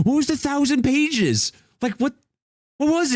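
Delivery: high-pitched, baffled demand; incredulous. Real, not acted. Baffled streamer reacting to the thousand pages, incredulous. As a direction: squeak it out in disbelief.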